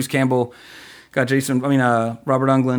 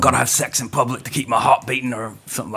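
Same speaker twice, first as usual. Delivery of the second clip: mock London accent, lightly done; Jason Statham impression; 2 semitones lower than usual. Host riffing on an action film for his co-host, in a loose mock English accent. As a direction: throw away a rough English action-hero accent. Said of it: "Just say his name over and over".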